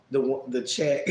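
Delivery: ordinary delivery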